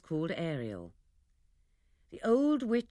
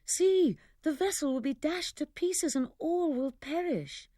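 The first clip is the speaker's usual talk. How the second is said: put-on much higher, urgent girl's cry; alarmed plea; 9 semitones higher, 3 dB louder than usual. Miranda begging her father to spare the ship: high, frightened cry. Miranda fears the ship's wreck.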